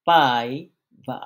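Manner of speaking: "পাই বা" with "পাই" loud and long, "বা" dropped; first-syllable stress. Shown as a demonstration. Teacher giving another example of the drop, strong first syllable in "পাই বা". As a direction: hit "পাই" hard, pause, let "বা" fall. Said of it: "ঠিক এরকম".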